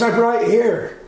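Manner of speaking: high-pitched imitation of a man calling back; retold call back. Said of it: "he goes".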